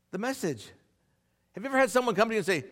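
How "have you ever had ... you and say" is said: ordinary talk, nothing marked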